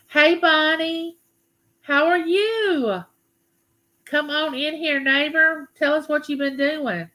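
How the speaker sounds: cheery greeting. Real, not acted. Welcoming a viewer into chat.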